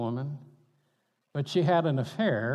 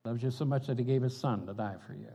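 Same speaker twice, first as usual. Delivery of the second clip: ordinary talk, nothing marked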